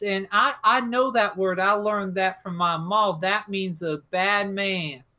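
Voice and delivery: acted child chatter, Southern dialect; rambling little boy. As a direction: babble on like a kid working it out. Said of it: "Billy just sort of doesn't shut up".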